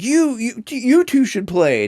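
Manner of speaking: high-pitched imitation of a pestering kid; excited kid. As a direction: pipe up like a kid egging adults on. Kid's line acted in retelling.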